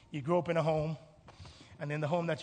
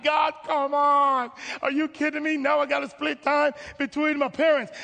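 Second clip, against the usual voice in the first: loud, high protest, stretching the opening plea; exasperated outcry; 7 semitones higher, 8 dB louder than usual. Acted. Child of divorce protesting to God, exasperated outcry. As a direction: complain at full voice to God. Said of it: "you're getting frustrated with life".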